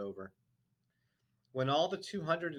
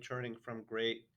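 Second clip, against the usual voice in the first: usual speaking voice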